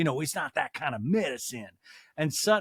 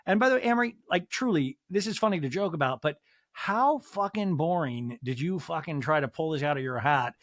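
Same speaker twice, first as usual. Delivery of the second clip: ordinary talk, nothing marked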